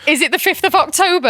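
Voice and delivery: excited guess. Retold or imitated. Guessing the only free week.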